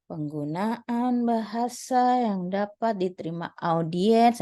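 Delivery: dragged-out, laboriously slow delivery; too slow. Shown as a demonstration. Presenter demonstrating how not to speak, too slow. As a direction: stretch every word far too long. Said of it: "tidak boleh terlalu lambat".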